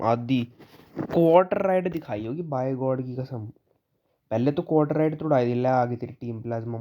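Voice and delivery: ordinary talk, nothing marked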